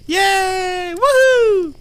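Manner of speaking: very high, squealed cheer; mock-jubilant whoop; playful cheering. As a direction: celebrate in a silly high voice. Cheering at being told he's loved.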